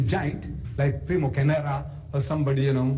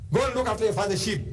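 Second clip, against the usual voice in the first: higher, quick brush-off; mild mock scornful commander; dismissive commander; 7 semitones higher, faster than usual. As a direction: wave the boy off. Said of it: "So what? You?".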